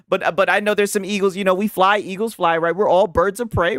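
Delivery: usual speaking voice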